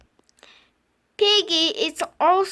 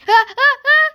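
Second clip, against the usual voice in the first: laugh; 6 semitones higher, 8 dB louder than usual. Acted.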